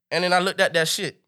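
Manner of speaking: ordinary delivery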